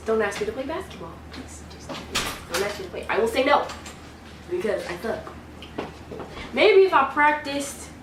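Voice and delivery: ordinary delivery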